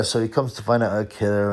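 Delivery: normal talking voice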